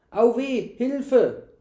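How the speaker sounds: high, loud cry for help; wailing. A narrator's reading. Servant grabbed by the knight, wailing. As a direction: wail in pain like a caught servant. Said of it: "jammerte der Knecht".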